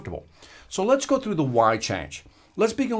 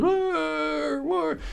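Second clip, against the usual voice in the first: high drawn-out dog moan; hound dog groan; 13 semitones higher, 4 dB quieter than usual. Retold or imitated. Speaker imitating the hound dog's moan for the audience. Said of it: "all you hear is".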